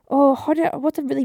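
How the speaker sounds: ordinary talk, nothing marked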